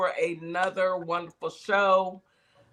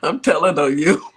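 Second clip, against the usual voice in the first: laughing tease; 2 semitones lower, 7 dB louder than usual. Real, not acted. Teasing, laughing.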